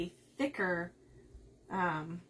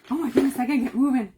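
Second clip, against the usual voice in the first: rushed hurry; 3 semitones higher, 9 dB louder, much faster than usual. Real, not acted. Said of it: "hurrying herself".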